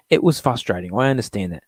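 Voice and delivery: ordinary talk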